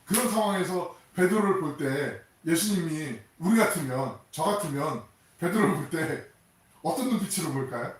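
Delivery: ordinary talk, nothing marked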